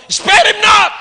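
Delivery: high, sudden command; shouted preaching. Real, not acted. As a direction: burst out at the uninterested listeners.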